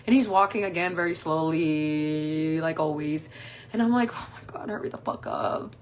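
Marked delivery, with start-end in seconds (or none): 4.1-5.8 whispered aside under the breath; hushed, exasperated mutter; muttered impatience. Acted. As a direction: hiss it privately, hiding the impatience. Impatient thought hidden from him.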